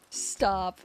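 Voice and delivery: ordinary talk, nothing marked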